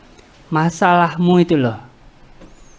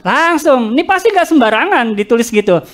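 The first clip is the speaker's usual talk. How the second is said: usual speaking voice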